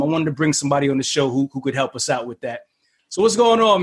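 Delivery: normal talking voice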